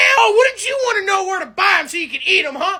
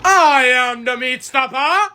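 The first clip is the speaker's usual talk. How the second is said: low, slow, booming Meat Stopper line; deep slow catchphrase; 7 semitones lower, 3 dB louder, slower than usual. Acted. Big guy booms his catchphrase at the crew. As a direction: announce yourself like a heavy brute.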